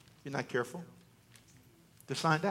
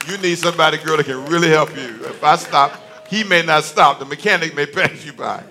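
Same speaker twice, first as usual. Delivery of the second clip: louder, driving the point home; raised preaching voice; 3 semitones lower, 9 dB louder than usual. Acted. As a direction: preach it out loud to the women. Preacher warns about calling for help.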